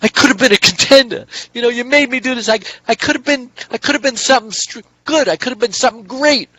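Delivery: imitating Brando's anguished crying. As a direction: wail the regret like a broken prizefighter. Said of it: "and he's crying".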